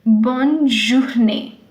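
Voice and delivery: bonne journée said slowly, bonne then zhoor-NAY; slow model of bonne journée; French bonne journée. Shown as a demonstration. Teacher slowly models bonne journée for students. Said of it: "this is a little tricky".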